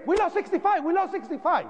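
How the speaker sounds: high, urgent repeated alarm call; urgent radio call. Retold or imitated. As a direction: relay the panicked radio message. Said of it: "on the radio we hear".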